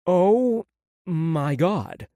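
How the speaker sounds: slow, drawn out through food; mouth full. A narrator's reading. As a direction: talk around a mouthful, slowly. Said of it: "I said with a mouthful of food".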